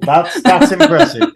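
high laughing ironic praise. Real, not acted. Host mocking the terrible actress, laughing. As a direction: laugh it out, mock-impressed.

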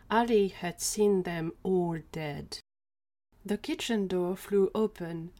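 usual speaking voice

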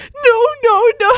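very high, loud mock wail; imitated screaming. Imitating friend on the ticket call, screaming. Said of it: "she just screamed".

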